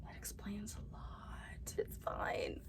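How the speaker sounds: self-mocking whispered aside; wry whisper. Real, not acted. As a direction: whisper it as a sheepish joke. Joking aside about book pile.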